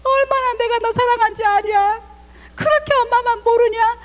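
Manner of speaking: love worn out and begging; pleading complaining mother. Retold or imitated. Older-generation mother reproaching her child, whining plea. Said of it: "사랑이 찌들고 ... 막 호소하는 거고".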